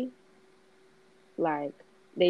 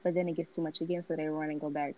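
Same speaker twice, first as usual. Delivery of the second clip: reading aloud; 6 dB quieter than usual. Acted. Reads a passage aloud.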